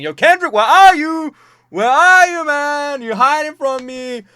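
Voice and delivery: high, loud taunting shout with drawn-out words; calling someone out. Retold or imitated. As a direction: holler it out like a public challenge. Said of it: "trying to call him out like that".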